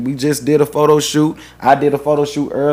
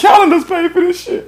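mock doting voice. Continues high mock voice.

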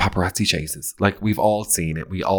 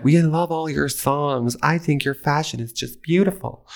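high, slow sweet gush; gushing socialites; 9 semitones higher than usual. Retold or imitated. Socialites gushing to Victoria in a high sugary voice. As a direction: coo it up high like adoring ladies. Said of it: "They're like".

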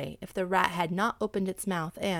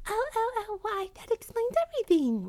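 wailing rat. A narrator's reading. Rat learning of the theft from the cat, wailing. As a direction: wail it as a distressed little rat. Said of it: "wailed the rat".

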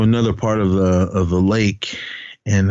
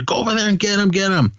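retold urgent urging; 12 semitones higher, faster than usual. Ricketts urging Peter toward the hunters, high retold urgency. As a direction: relay a frantic order in a raised pitch. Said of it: "Ricketts was telling Peter".

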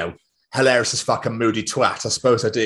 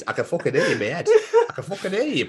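laughing; 4 semitones higher than usual. Real, not acted. Laughing at imagined listener.

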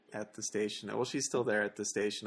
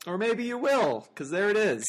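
high, mocking anchor aside; sarcastic; 9 semitones higher, 5 dB louder than usual. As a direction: poke fun at the lazy edit. Anchor mocking the repeated video.

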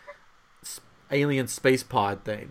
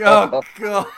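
retold freak-out; 7 semitones higher, 10 dB louder than usual. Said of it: "I'm, like, freaking out".

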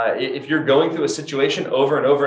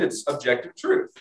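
ordinary delivery

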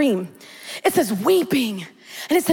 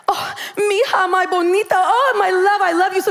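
doting mother; 4 semitones higher than usual. Retold or imitated. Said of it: "I love her, right?".

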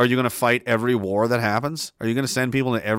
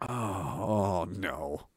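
mock despair; 6 semitones lower, 12 dB quieter, much slower than usual. Real, not acted. Reacting to gross chat message.

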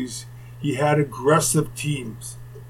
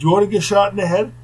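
higher, louder, fast mock Brooklyn accent; 5 semitones higher, 5 dB louder, faster than usual. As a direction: throw the threat back in a mocking Brooklyn accent. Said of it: "I started mocking his accent".

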